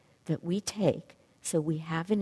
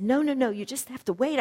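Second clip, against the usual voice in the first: excited protest; 8 semitones higher, 4 dB louder than usual. Retold or imitated. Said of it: "And he said".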